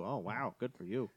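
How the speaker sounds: ordinary talk, nothing marked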